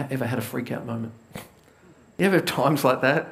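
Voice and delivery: ordinary delivery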